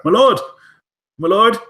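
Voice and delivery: high, loud call across the yard. Acted. Servant calling to the prince across the courtyard, high and loud. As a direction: shout for his lord from a distance.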